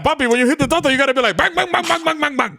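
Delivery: light Dominican dad voice; a hint of a Dominican father; dominican accent. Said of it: "Doing the Dominican accent".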